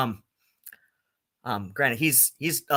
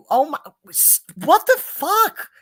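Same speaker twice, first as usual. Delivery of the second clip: much higher, louder, drawn-out disbelief; appalled outburst; 12 semitones higher, 5 dB louder than usual. Real, not acted. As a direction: cry out in real, high-pitched disbelief. Appalled at the mispronunciation.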